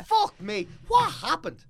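high, loud exasperation; exasperated outburst. Real, not acted. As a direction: throw up your hands, loud.

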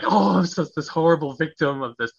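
high, loud hammed-up self-pity; mock whiny victim. Refusing to act like a victim, mock self-pitying wail. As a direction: overplay the poor-me whine.

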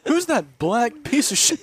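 mock ad voice. Mock ad announcer voice.

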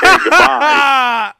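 very high, very slow sing-song goodbye; mock high phone voice. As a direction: chirp a drawn-out goodbye like the babysitter. Mimicking babysitter on phone.

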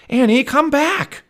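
high, drawn-out urgent shout. A narrator's reading. Jack shouts after Annie running downhill, loud and urgent. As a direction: yell after someone running away. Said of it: "Jack shouted".

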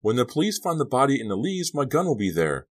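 high, loud and frantic; panicked outburst. A narrator's reading. Boy afraid police will find his gun, frantic shouting. Screamed outburst at Della continues.

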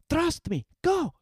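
high, rising urgent push; urgent urging. Acted. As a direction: send him off fast. Zeri urging frightened boy.

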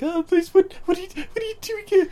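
very high, stammering victim voice; imitated terrified woman; mock freaking out. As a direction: plead in a hammed-up panicked falsetto. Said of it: "she's just freaking out".

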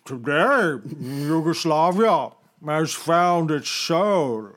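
slow, drawn-out statesman impression; ponderous Churchillian oratory; Winston Churchill. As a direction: proclaim it slowly like a wartime orator. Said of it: "Winston Churchill said".

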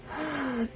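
a short soft laugh breaking through; high breathy giggle. Real, not acted. Reader amused by the holy hell line, quiet high giggle. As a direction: let a giggle slip out.

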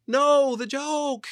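high, loud, drawn-out mock wail; anguished cry. Comic watching the edit miss the gag, mock anguished yell. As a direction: cry out slowly in comic despair. Retold cry at botched TV cut.

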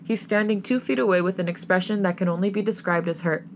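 plain reading voice

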